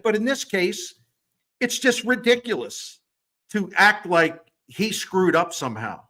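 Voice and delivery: fired up defending fairness; worked up. Real, not acted. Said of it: "I get worked up about this".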